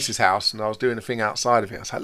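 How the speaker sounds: ordinary talk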